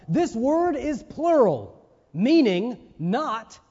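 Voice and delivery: slow, high and loud, weight on every word of "This word is plural"; emphatic. Real, not acted. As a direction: drive home "plural, meaning not", word by slow word. Preacher drives home key point.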